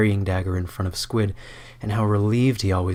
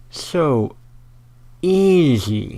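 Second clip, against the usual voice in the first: higher smug gloating voice; smug villain; 8 semitones higher, 5 dB louder, much slower than usual. Acted. Skorbrand gloating to himself, smug and higher. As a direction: savor the win, smugly. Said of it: "he thinks".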